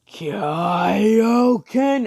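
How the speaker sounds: long, high battle cry; drawn-out, full-voiced power-up yell; battle scream. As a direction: scream it out like an anime attack. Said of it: "goes and screams".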